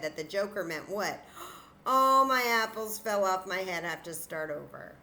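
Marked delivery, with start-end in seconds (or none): 1.5-3.8 mock shock. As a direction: gasp then louder mock shock. Gasp at joker rule.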